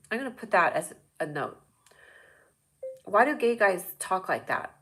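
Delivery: usual speaking voice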